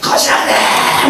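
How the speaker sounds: strained, drawn-out shout; slow, rasping mock scream; angry outburst. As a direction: yell back in acted fury. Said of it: "또 막 화를 내면서".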